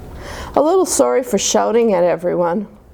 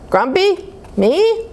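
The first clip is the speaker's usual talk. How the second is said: indignant squeak; 9 semitones higher, slower than usual. Acted. Jim denies being grumpy.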